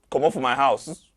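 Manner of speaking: a raging eviction roar; furious shout. Acted. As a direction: bellow him out of the house. Repeated order shouted.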